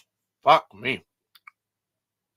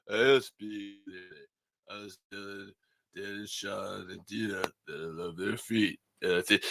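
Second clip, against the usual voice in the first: Stallone impression; 4 semitones lower, 5 dB quieter than usual. Stallone phoning the podcast hosts, in a lower Stallone voice. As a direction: do Sylvester Stallone confessing to the hosts. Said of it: "I was trying to do Stallone".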